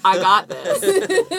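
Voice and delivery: put-on Scottish groundskeeper accent. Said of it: "you think you can do a groundskeeper".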